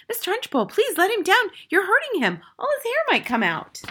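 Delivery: very high, distressed plea; alarmed, high-pitched teacher; Miss Honey crying out. A narrator's reading. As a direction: beg urgently for the child. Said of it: "Miss Honey cried out".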